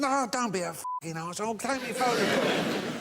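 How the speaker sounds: put-on high, pushy drunken demand, slowing at the end; insistent drunk. Drunk insisting David Bailey take his photo, high and pushy. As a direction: lean on him like a drunk who won't quit. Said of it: "He said".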